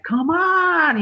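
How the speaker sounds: put-on high, drawn-out toddler whine; whiny tantrum. Little daughter starting a tantrum at dad, whiny imitation. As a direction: stretch it out like a whining kid. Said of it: "starting to get, like, a fucking tantrum".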